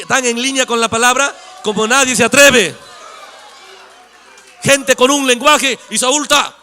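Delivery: loud, fervent sermon proclamation; full-force preaching. Real, not acted. Preacher thunders about the believers. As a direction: proclaim it to the congregation.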